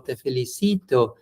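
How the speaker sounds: normal talking voice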